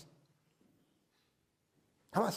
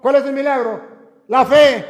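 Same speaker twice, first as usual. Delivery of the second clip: loud, higher preaching cry; preaching call; 8 semitones higher, 16 dB louder than usual. Acted. Priest calling out the miracle to his flock, loud and high. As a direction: ring out the answer to the congregation. Preaching climax, rhetorical question.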